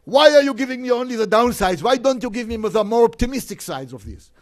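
imitating an angry, nervous outburst, high and loud; loud, high-pitched exasperated demand; Saddam Hussein. Saddam berating his cousin for only giving downsides, angry and nervous. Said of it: "you hear in his voice this anger. He's angry, he's nervous".

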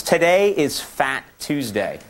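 normal talking voice

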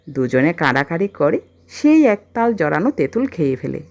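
ordinary delivery, nothing marked

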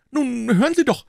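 loud, very high nervous character voice; timid protest. A narrator's reading. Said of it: "begann Mister Jones furchtsam". (furchtsamer, hoher Einwand)

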